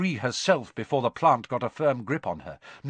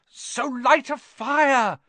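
high, slow, strained shout; choking; 12 semitones higher, 5 dB louder, slower than usual. A narrator's reading. Boy in the plant's grip, choking. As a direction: force it out while being strangled. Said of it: "Harry choked".

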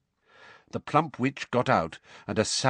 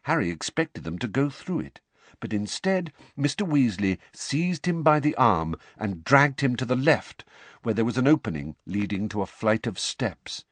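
ordinary delivery, nothing marked

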